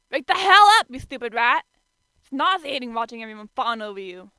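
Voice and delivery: very loud rude yell; yelling in ear. A narrator's reading. Kyo yelling at sleeping Yuki, very loud. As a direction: yell right in his ear. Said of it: "very loud in his ear".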